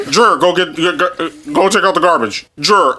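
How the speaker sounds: halting, slurred dad ordering a chore; mocking dad impression. Son mimics dad demanding chores, mocking impression. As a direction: bumble through the order as a winded dad. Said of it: "He literally will sit there and just be like".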